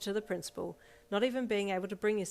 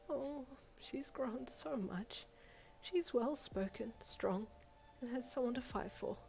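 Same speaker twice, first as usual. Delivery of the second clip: tearful; 6 semitones higher, 4 dB quieter, slower than usual. Acted. Principal alone, tears flooding down.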